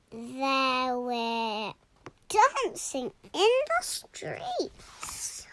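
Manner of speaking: plain reading voice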